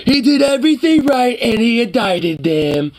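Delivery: very high put-on wail; mock outcry. Streamer parodying a reaction to political news, mock outcry. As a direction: wail it high in exaggerated outrage.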